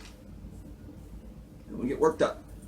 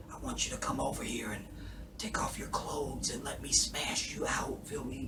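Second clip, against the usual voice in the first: soft breathless whisper; David whispering; 8 dB quieter than usual. Retold or imitated. Imitating David whispering to his wife. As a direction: whisper it like a spent man. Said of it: "this is David when he's just talking".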